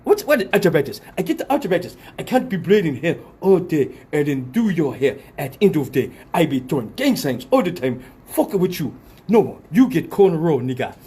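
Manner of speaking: put-on indignant African-accented rant; mock complaining in a put-on African accent. Braider with arthritis refusing the customer, African accent. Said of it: "this is my African accent".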